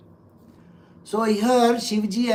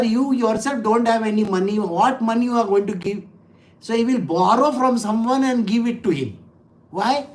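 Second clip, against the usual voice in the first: usual speaking voice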